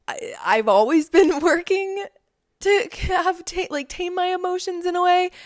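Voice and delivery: high, strained laughing voice; real laugh squeezing the words upward; laughing while talking. Real, not acted. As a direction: talk through a laugh, pitch riding high. Amused at her own temper.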